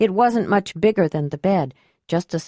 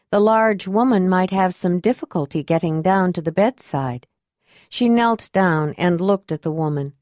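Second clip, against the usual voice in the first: plain reading voice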